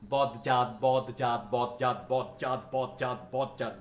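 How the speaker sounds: বদজাত with a clear d before j, tripping; clumsy with d sound. Shown as a demonstration. Teacher showing the hard d pronunciation. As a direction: labour through বদজাত. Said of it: "দশ বার বলতে পারবো তাড়াতাড়ি".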